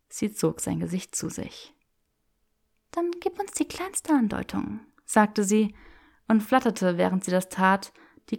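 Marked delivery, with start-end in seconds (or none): 2.9-4.8 put-on higher, playful young woman; teasing Hermione voice. Granger teasing Draco in the bookshop, playful and higher. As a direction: tease him with mock refusal.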